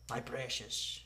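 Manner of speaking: very soft creature voice; hushed fantasy creature crooning over treasure; Gollum. Acted. Player doing a character clue, hushed creature voice.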